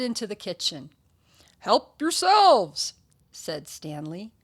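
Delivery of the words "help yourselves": loud, high, slow invitation; generous host calling. Acted. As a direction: draw it out loudly to all the guests. Stanley invites guests to eat.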